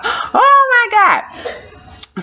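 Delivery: high-pitched, acted lament; drawn up high in mock despair; distraught wail. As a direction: wail it like a reenacted meltdown. End of acted tearful protest.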